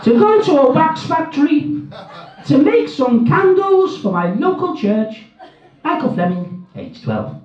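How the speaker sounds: put-on high, childlike read-out voice; child reading letter. Comic reading of a boy's letter to Jim. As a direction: pipe it up like a twelve-year-old boy.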